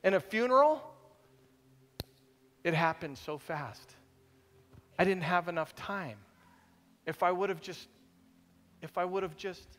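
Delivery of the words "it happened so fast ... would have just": hushed grieving. Retold or imitated. Grieving funeral mourners.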